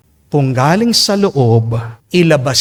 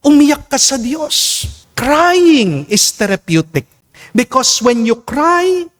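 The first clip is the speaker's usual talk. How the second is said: high, slow, drawn-out sermon voice; fervent preaching; 9 semitones higher, slower than usual. Acted. Preacher urging the congregation to cry to God, fervent and high. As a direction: pull the crowd in with each stretched phrase.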